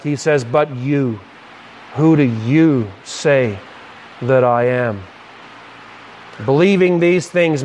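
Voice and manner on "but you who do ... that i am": low, slow, weighty emphasis on you; Jesus turning to his disciples, emphatic and slow; emphatic question. Retold or imitated. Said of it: "is very emphatic".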